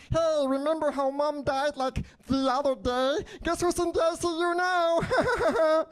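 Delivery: very high cartoon squeak with a giggle at the end; absurdly high, chirpy falsetto; changed sick voice. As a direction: squeal it like a voice gone wrong. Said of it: "it's changing my voice".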